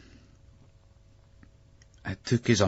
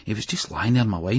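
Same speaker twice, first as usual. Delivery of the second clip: ordinary delivery, nothing marked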